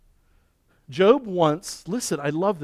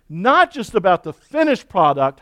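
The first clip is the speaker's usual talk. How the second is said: normal talking voice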